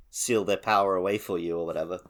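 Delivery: ordinary talk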